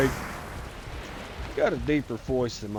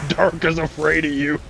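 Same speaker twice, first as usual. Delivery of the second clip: laughing; 3 semitones higher, faster than usual. Real, not acted. Laughs repeating own punchline.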